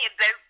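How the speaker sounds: "about" said as "aboot"; mock-Canadian pronunciation. Shown as a demonstration. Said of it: "We don't say about".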